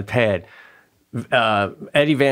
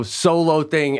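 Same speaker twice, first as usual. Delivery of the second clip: normal talking voice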